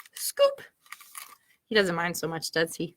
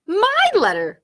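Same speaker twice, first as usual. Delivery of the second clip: higher, louder, but a notch below Bunny; crying out; 8 semitones higher, 4 dB louder, slower than usual. Acted. Squirrel snatching the letter back from Bunny, crying out. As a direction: cry it out, grabbing it back. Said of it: "cried Squirrel".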